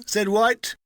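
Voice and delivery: ordinary delivery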